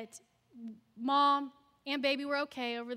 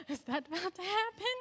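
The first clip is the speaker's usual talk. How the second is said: disbelief; 7 semitones higher than usual. Retold or imitated. Shocked by screams next door.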